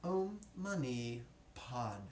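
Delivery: drawn-out, evenly held syllables; syllable drill. Shown as a demonstration. Teacher leading students in syllable stacking, drawn-out drill. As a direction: model it slowly for repeating. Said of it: "And now four".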